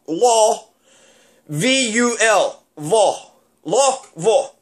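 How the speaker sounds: law vol said clipped in two syllables; law, vol, lazy Kentucky Louisville; local pronunciation. Shown as a demonstration. Showing the proper local Louisville, law vol. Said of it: "the way you're supposed to pronounce it".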